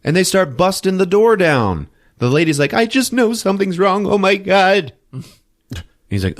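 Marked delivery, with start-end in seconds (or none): 2.7-4.9 high, fretful woman impression; mock panicked lady. Lady urging cops on at the door, mock panic. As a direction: pitch it high, imitating a frantic witness.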